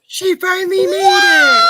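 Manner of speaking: high, loud happy cheer; excited shout. Real, not acted. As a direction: cheer as she arrives. Greeting a friend arriving.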